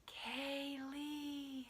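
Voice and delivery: soft, eerie, far-off call; a ghostly lure from the store aisle; aisle calling her name. Pretend. Store aisle calls her name, soft and eerie. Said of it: "it was calling out to me".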